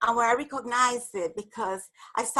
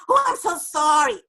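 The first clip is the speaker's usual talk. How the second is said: gushing apology; 6 semitones higher, 7 dB louder than usual. Retold or imitated. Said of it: "the inner dialogue".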